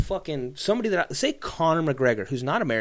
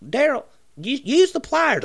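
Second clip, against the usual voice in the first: pitched way up, scolding Daryl; high nagging wife voice; 11 semitones higher, 4 dB louder than usual. Retold or imitated. Woman in video scolding Daryl, high nagging voice. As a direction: nag him shrilly about the pliers. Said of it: "voicing woman in the video".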